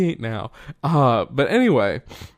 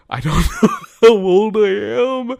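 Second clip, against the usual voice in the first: laughs mid-sentence, pitched up and louder; laughing; 10 semitones higher, 6 dB louder than usual. Real, not acted. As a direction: crack up while admitting it. Laughing at forgetting own age.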